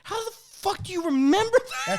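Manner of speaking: very high, loud astonished cry; stunned disbelief. Retold or imitated. Telling friends his shock that the butcher remembered, very high and loud. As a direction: squeal in disbelief. Amazed reaction retold.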